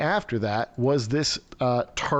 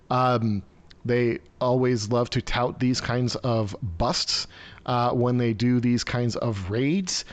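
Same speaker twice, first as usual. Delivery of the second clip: ordinary talk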